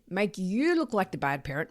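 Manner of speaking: ordinary talk, nothing marked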